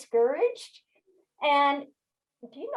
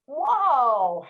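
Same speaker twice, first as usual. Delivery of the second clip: drawn-out call to halt a horse; raised voice, rising then falling in one long cry; retold shout; 2 semitones higher, 4 dB louder than usual. Rider pulling back on the reins, shouting to stop her horse. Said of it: "and shouting".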